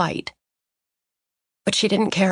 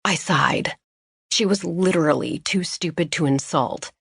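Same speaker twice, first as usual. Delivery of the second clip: usual speaking voice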